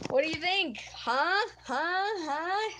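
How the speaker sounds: high, bouncy, gushing; giddy excited. Real, not acted. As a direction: gush over the new shirt. Showing off shirt, giddy.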